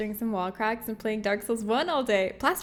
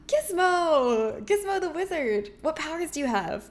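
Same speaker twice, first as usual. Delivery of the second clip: excited gasp and greeting; 6 semitones higher than usual. Real, not acted. Excited at a new follower.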